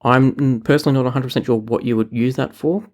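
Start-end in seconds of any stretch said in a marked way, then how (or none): none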